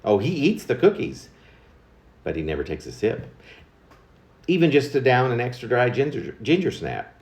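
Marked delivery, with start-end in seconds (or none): none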